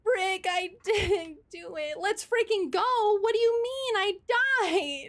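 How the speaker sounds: loud, high shouting in disbelief; frustrated shout. Real, not acted. Dies in game, shouting.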